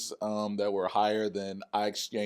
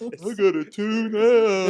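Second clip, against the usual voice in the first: higher, drawn-out mimicry of put-off viewers; mock outrage; 8 semitones higher, 4 dB louder, much slower than usual. Co-host mimics viewers rejecting the halftime show, mock outrage. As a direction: stretch it out as a mock complaint.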